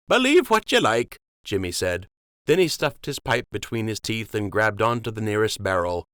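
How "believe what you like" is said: dismissive docker. Acted. Jimmy shrugs it off.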